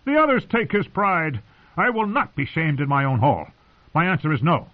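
high, indignant refusal; proud, angry defiance; seething. A narrator's reading. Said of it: "Edmure was seething".

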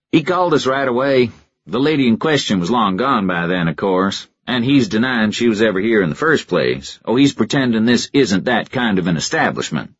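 easy, knowing local detective; Stockton voice. A narrator's reading. Stockton explains.

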